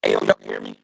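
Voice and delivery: muffled robotic bad-line voice; underwater. Real, not acted. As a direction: sound like you're talking underwater. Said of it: "you sound like you're underwater".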